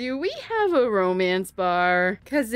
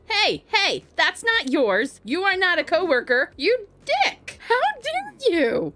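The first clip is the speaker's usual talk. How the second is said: high, loud mock indignant scolding; hammed-up telling off, pitched high; mock outrage; 10 semitones higher, 4 dB louder than usual. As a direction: tell off the cheeky Sim, playing it up. Scolding a Sim taking her drink.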